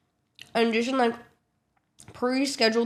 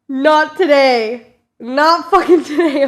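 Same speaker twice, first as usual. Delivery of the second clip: loud, drawn-out exasperation; louder and slow, at the end of your rope; fed-up; 4 semitones higher, 10 dB louder, slower than usual. Retold or imitated. Passenger fed up with screaming iPad kids, exasperated.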